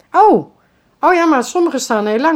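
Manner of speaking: loud, high indignant oh; startled objection; surprised protest. Retold or imitated. Neighbours protesting in retold argument.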